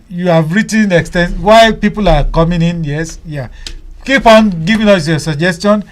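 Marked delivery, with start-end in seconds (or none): none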